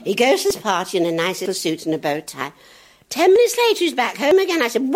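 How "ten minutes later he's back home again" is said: high, loud comic build; rising excitement before the joke; excited build-up. Real, not acted. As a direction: push it up for the punchline. Storyteller building.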